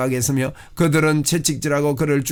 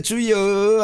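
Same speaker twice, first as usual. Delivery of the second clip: short prayer cry; 6 semitones higher, much slower than usual. Shown as a demonstration. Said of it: "하는데요".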